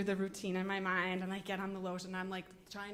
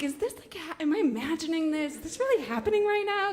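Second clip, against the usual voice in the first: climbing higher and faster in disbelief; rising disbelief; 7 semitones higher, 4 dB louder than usual. Retold or imitated. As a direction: build from puzzled to frantic. Priest serenades her alone.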